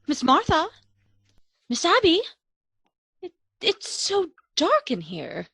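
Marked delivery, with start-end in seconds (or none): none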